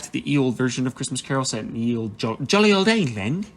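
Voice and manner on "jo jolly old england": a quick, terrible mock British accent, pitched up; bad British accent. Describing ye olde England in a self-admittedly terrible British accent. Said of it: "That was a terrible ac-, accent, I'm sorry".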